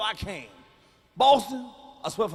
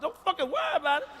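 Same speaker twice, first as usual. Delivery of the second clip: higher-pitched, irritated repeat; exasperated brush-off; 7 semitones higher than usual. Acted. Mom to nosy child about auntie, exasperated high-pitched brush-off. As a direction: lose patience and shut the question down.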